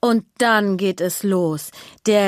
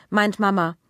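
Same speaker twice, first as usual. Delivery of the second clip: plain reading voice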